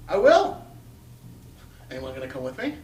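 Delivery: usual speaking voice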